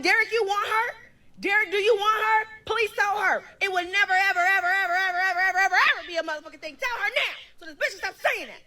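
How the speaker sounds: high-pitched, heated retold plea; insistent demand. Demanding Derek tell her it would never happen, insistent demand. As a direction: pile up the evers, pitched high.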